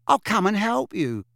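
high, bright offer. A narrator's reading. Witch offering to help Harry pack, bright. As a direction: chirp it, eager to help. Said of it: "said Tonks brightly".

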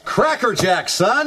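high, laughing gee-whiz father; exaggerated sitcom dad. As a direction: cheer like a corny TV dad. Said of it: "are you sure you want it that exaggerated".

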